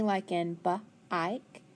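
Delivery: slow b-ike, b split off before ike; segmented b-ike. Shown as a demonstration. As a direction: say b-ike sound by sound. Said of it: "I consonant E".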